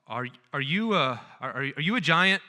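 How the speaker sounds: frightened Shasta. Acted. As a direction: stutter it out in terror. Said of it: "asked Shasta".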